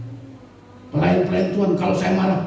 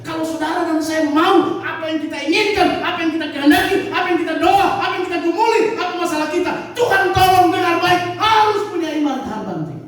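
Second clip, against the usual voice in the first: full-force preaching; 14 semitones higher than usual. Acted. Pastor preaching to the congregation, full-force preaching. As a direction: hammer the point home to the flock.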